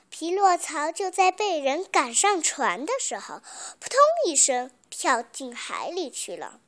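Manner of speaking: usual speaking voice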